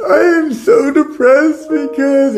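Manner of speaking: high, crying mock lament; mock depressed wail. Answering the question why so depressed, a high crying mock wail. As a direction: wail it like a sobbing teen. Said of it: "why are you so depressed".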